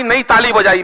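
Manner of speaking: usual speaking voice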